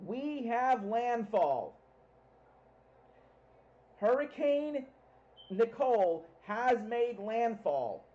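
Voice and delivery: loud, slow landfall announcement; yelling over hurricane winds. Real, not acted. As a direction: shout it out, drawn out.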